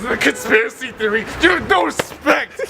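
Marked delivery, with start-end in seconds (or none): 1.4-2.6 much higher scolding cry; indignant shout. Acted. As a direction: scold them in outrage. Scolding outburst.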